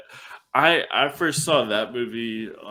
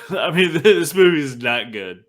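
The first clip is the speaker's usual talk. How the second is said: higher, louder, amused verdict through laughter; laughing; 7 semitones higher, 7 dB louder than usual. Real, not acted. Host admitting the movie is bad, laughing.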